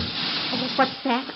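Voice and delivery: sudden high little start; startled. Acted. Housewife distracted from the sergeant, startled. Distracted by kitchen mid-arrest.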